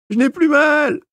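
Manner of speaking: very high, loud panicked yelp; frightened patient yelping at the dentist. A narrator's reading. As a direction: squeal it to escape the chair. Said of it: "glapit l'infortuné".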